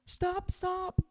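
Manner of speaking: high, squeaky cry of protest; a little mouse squeaking stop; shouted. Acted. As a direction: mouse begging the rooster to stop. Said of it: "shouted the little mouse".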